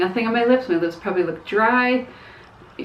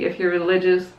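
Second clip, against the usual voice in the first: ordinary talk, nothing marked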